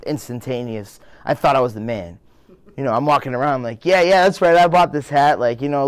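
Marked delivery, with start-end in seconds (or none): 3.8-5.4 louder cocky boast; cocky swagger. Retold or imitated. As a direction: strut and brag. Cocky self at mall.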